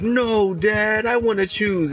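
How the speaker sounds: put-on high, loud protesting kid; high-pitched, drawn-out mock tantrum; whiny son. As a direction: whine at Dad like a spoiled child. Acting son protesting to his dad.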